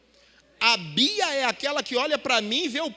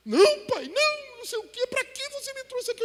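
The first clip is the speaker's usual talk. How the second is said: shy daughter protesting; 14 semitones higher than usual. Retold or imitated. Shy daughter refusing surprise.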